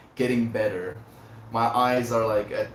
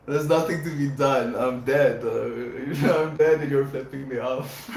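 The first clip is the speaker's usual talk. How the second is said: mock despair breaking into laughter; laughing defeat; 3 semitones higher, 4 dB louder than usual. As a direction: act out giving up, laughing through it. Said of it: "a sheer sense of defeat".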